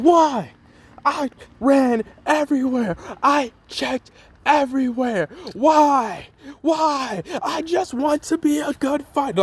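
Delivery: high, strained voice breaking into a shout; anguished Deku cry. Retold or imitated. Deku cries out in frustration after failing to find training, high and desperate. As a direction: cry out in despair like a beaten kid. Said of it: "He's like".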